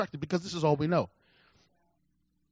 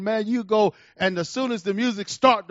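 usual speaking voice

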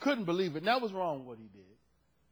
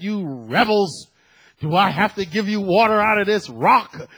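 loud, stretched-out indignation; imitating an exasperated prophet's booming challenge; acted angry rebuke; 2 semitones higher, 11 dB louder than usual. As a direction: thunder at a complaining crowd. Acts out Moses rebuking the people.

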